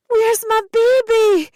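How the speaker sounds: very high, loud, slow panicked scream. A narrator's reading. Mother losing her baby from sight, screaming. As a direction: scream in desperation. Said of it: "I screamed over and over".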